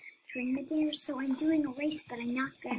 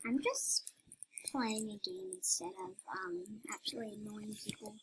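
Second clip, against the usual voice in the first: whispering; 7 dB quieter than usual. Real, not acted. Whispered aside.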